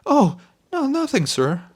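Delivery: high, loud, flustered backpedal; alarmed denial. Acted. Servant frightened by master's glare, flustered high denial. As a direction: hurry to take it back.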